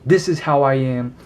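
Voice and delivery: usual speaking voice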